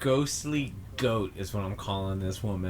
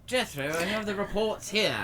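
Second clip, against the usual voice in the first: put-on a light British accent, pitched high like the coroner Ducky; lightly voiced British medical examiner reporting to Jethro; 8 semitones higher than usual. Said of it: "there's a weird British accent to it".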